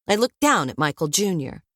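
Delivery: ordinary delivery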